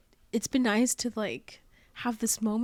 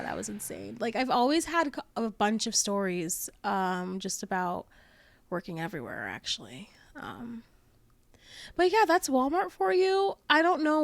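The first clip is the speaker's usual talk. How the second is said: normal talking voice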